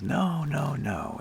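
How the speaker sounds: lightly playing an Italian tennis player; Italian accent. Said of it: "charming Italian accent".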